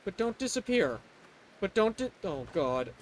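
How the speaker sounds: soft, low pleading under the breath; hushed plea. Real, not acted. Streamer quietly begs the Pokemon not to vanish, soft pleading.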